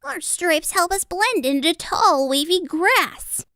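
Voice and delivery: high storybook zebra; high-pitched animal character. A narrator's reading.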